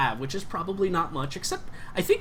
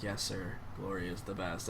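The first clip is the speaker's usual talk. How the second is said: much softer, lower, worn-out agreement; weary; 7 semitones lower, 15 dB quieter than usual. Acted. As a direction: say it like you're done with everything.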